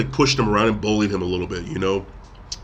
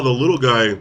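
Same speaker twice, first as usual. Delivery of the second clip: ordinary talk, nothing marked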